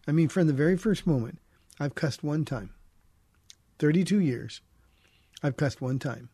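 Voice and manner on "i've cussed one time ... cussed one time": usual speaking voice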